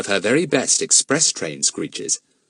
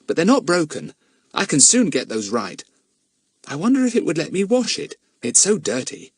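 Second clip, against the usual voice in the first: put-on boy voice; 6 semitones higher, 3 dB louder than usual. Philip voiced at length.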